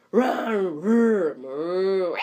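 loud playful roars; mock lion roaring in the library. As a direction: roar like a storybook lion. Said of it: "So it'll be like".

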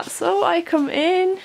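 normal talking voice

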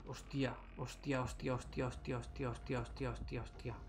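soft panicked muttering, fading. Real, not acted. Panicked repeated swearing, fading.

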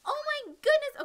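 real surprised cry. Real, not acted. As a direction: shout it out, genuinely amazed. Spots a rare Pokemon.

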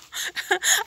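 high, breaking laugh; real chuckle. Real, not acted. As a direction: let another giggle escape. Laughs at own remark on calm.

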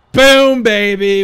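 loud, high burst on "boom"; hyped exclamation. Real, not acted. Streamer capping his eight-day-week joke, hyped shout. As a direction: shout it like a punchline landing.

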